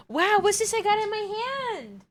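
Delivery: high, feigned wonder for a pet; sing-song high bait to coax a cat; luring a cat. Pretend. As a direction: pretend amazement in high baby talk.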